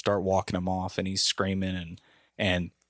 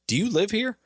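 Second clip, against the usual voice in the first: much higher, incredulous challenge; confrontational challenge; 13 semitones higher, 3 dB louder than usual. Retold or imitated. Drunk neighbor challenging the visitor, confrontational. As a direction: challenge them with a high-pitched question. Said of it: "he starts coming back at me".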